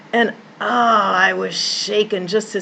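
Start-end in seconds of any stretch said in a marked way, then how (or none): none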